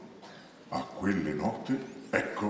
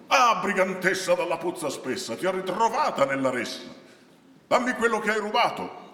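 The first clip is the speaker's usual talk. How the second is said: louder, raised accusing cry; angry shout; 12 semitones higher, 5 dB louder than usual. Acted. Robbed Patrizio confronts the brigand woman in the crowd, crying out. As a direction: cry out at the thief, pitch up. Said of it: "e gridò".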